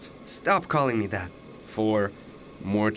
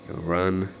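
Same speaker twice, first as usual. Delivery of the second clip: normal talking voice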